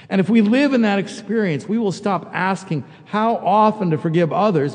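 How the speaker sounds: loud, high, rising sermon climax; preaching at full force. Acted. Pastor urging congregation, rising to a loud preached climax. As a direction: build it up and hammer it home.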